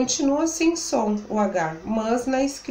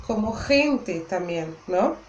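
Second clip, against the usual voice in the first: ordinary talk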